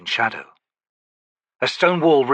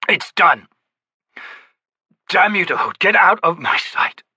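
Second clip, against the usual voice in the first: loud, higher, bitter; furious dismissal; 7 semitones higher, 3 dB louder than usual. A narrator's reading. Gruntle ordering the priest Karuli out, furious. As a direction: drive the priest away in anger.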